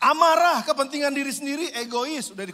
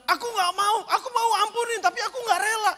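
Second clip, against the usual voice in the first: higher, whiny reluctant voice; grudging whine; 8 semitones higher than usual. Retold or imitated. Congregant who won't forgive, a higher whining protest. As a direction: pout as you refuse to forgive. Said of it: "saudara bilang".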